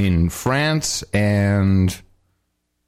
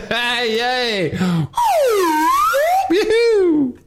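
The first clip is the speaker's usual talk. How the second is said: mock cheer; much slower than usual. Mock cheer about war.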